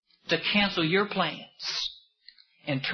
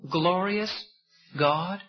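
ordinary talk

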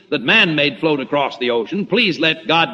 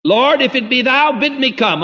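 higher, pleading call to the Lord; pleading Peter; 6 semitones higher than usual. Retold or imitated. Peter on the water begging his Lord to call him again, pleading and higher. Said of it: "use the same tone of voice".

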